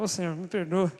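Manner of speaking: low, humbled plea for forgiveness; mock contrite plea. Preacher acting himself repenting before God, humbled plea. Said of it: "comecei a fazer assim".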